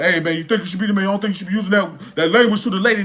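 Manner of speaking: fast, mocking do-gooder voice; a mock meddler lecturing the boyfriend, fast. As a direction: rattle it off like a meddling stranger. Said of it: "and you over here".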